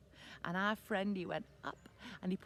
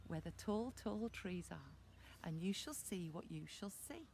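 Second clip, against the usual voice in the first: mysterious mum; 3 semitones lower, 10 dB quieter than usual. Acted. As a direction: lower it gently, like a secret to discover. Mum sends boy with mysterious hint.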